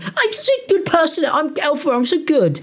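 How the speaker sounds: high, sugary mock voice of a self-praising influencer; mocking Elphaba. Vlogger mocking a TikToker's fake goodness, high sneering voice. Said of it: "you're actually doing such a good thing".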